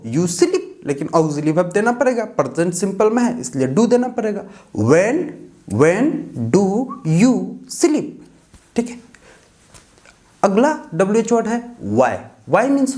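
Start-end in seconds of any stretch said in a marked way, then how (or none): 4.9-8.1 each word split off by a clear pause; teacher spelling out a question. Shown as a demonstration. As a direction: dictate it slowly, one word at a time. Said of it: "teacher dictates word by word".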